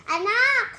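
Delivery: calling out. Pretend. Mother calls her child from the kitchen, loud. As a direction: call the kid from the kitchen.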